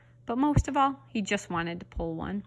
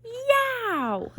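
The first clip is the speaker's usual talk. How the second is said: sudden very high yelp; scream; 3 dB louder than usual. A narrator's reading. Leslie shrieking suddenly in class. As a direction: shriek out of nowhere. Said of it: "Leslie screamed".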